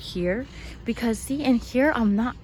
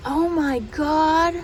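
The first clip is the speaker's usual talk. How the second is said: slow, loud, higher oh my God; stunned exclamation; 8 semitones higher, 6 dB louder, much slower than usual. Real, not acted. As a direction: recoil at the sight. Sees remains with skin and hair.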